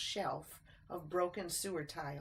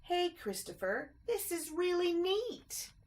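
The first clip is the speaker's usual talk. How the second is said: high, slow delighted call; thrilled by the puddle; excited; 11 semitones higher, 3 dB louder than usual. A narrator's reading. Ted calling Christopher to the puddle, high and delighted.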